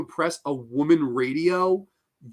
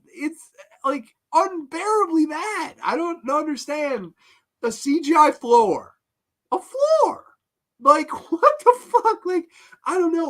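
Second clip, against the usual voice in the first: high, squeaky incredulity; real comic meltdown of bewilderment; losing it; 10 semitones higher than usual. Real, not acted. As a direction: plead in exasperated disbelief, voice way up. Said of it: "I'm losing my shit".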